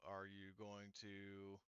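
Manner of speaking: ordinary talk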